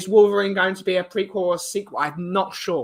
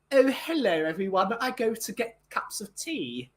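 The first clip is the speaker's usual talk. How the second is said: mock prim English accent, starting high; prissy tea-party lady, lightly hammed; posh English daughter; 4 semitones higher, 5 dB quieter than usual. Mocking the captain's daughter, prim English voice. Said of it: "his daughter is like".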